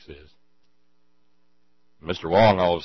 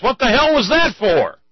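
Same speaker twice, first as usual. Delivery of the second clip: indignant shout; 10 dB louder than usual. Acted. Punched man demands why in joke.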